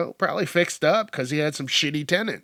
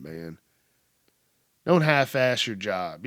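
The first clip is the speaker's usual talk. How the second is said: ordinary delivery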